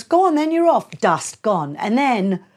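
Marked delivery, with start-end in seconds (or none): 0.0-0.9 teasing dare. Retold or imitated. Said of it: "he goes".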